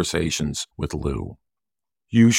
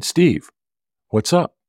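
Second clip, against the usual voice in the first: bright, higher cheerful phone greeting; happy voice; 9 semitones higher, slower than usual. Retold or imitated. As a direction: greet a friend happily on the phone. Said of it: "he answered the phone with a happy voice".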